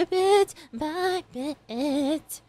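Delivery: very slow title; host stretching the episode title; drawn-out title. Real, not acted. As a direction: draw the title out slowly.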